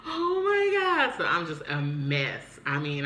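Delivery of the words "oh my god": very high, slow, loud cry; drawn-out distraught exclamation; mock-horrified shock. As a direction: wail it in stunned disbelief. Shocked at news, retold.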